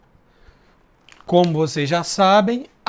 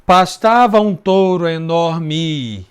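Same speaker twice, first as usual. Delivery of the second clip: slow, louder syllable count; slow scansion; 5 dB louder, slower than usual. Shown as a demonstration. Teacher scanning the verse for students, slow and louder. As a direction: drag each syllable out, counting. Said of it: "Outra lisão".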